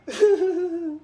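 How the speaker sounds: hammy high sobbing laugh; fake sob. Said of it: "I know I'm a terrible actor".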